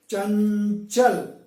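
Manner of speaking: चंचल with the nasal held, then चल; slow demo चंचल, hummed middle; lengthened nasal. Shown as a demonstration. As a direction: stretch the n in चंचल before the second syllable. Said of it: "पहले ये बोलें".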